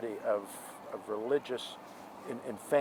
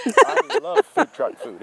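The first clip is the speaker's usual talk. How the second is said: excited; 14 dB louder, slower than usual. Real, not acted. Repeats it with more gusto.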